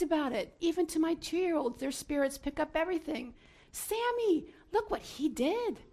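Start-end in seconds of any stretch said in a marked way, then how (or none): 3.7-5.8 put-on high, animated talk to a little child; excited parent. Mother telling her young child about a hero, excited. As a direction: coo it up high to impress a toddler.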